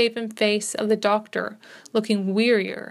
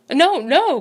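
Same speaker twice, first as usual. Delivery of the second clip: raised, higher pleading cry; cried out; 6 semitones higher, 6 dB louder than usual. A narrator's reading. Johnson begging the doctor not to leave, raised anxious cry. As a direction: cry out to stop him, louder. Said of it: "cried Johnson".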